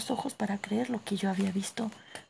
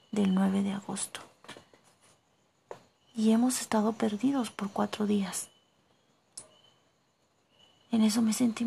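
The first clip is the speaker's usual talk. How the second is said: whispered narration. A narrator's reading. Narrator whispering the cave story to listeners. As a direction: hush the tale as if not to wake anyone.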